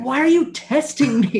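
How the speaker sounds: high, loud protest; exasperated dad. Retold or imitated. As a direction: cry out at being quizzed by your kid. Dad retells exasperated outburst at son.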